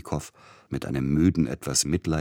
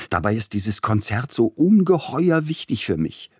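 higher, plaintive whine; anxious young pianist complaining; lamenting; 6 semitones higher, 4 dB louder than usual. A narrator's reading. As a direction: plead how much it matters. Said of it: "klagte er".

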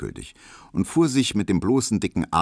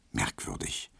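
murmured; 11 semitones lower, 11 dB quieter than usual. A narrator's reading. Nachdenkliche Erzählbemerkung.

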